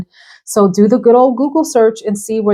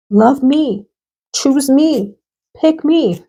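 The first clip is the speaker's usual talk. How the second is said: pleading perfume bottles; 5 semitones higher, much slower than usual. Pretend.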